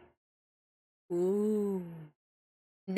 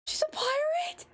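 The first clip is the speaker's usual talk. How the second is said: squealed, much higher question; shocked high cry. Real, not acted. Learning the killer is a pirate, a high shocked squeal. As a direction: shriek it in delighted disbelief.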